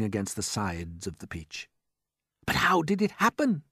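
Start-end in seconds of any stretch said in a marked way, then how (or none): 2.5-3.6 higher, bewildered cry; cried. A narrator's reading. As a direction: wail the question. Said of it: "they cried".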